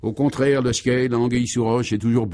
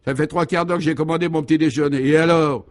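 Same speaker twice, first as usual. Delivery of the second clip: raised, higher voice of indignation; irate complaint; 5 semitones higher, 4 dB louder than usual. A narrator's reading. Hotel guest demanding his late breakfast, irate. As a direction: complain crossly and demand action.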